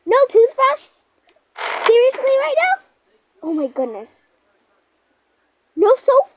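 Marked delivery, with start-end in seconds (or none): 0.0-2.8 high, loud squeal of disbelief; outraged complaint. Pretend. Girl to her family about the house, high loud outraged squeal. Pretend family finds nothing there. 5.7-6.3 high, loud squeal of disbelief; outraged complaint. Pretend. Girl to her family about the house, high loud outraged squeal. Pretend family finds nothing there.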